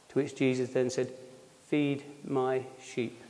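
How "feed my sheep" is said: solemn quiet. Acted. Jesus' closing words to Peter.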